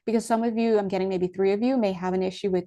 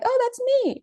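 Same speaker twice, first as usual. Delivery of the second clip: much higher squeak of alarm; lightly mocking the anxious viewer; mock worried viewer. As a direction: mimic the viewer who panics. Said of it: "Don't be like".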